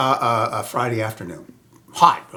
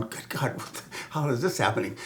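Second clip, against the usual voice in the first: hushed disbelief; 2 semitones lower, 9 dB quieter than usual. Retold or imitated. Freaked-out inner thought.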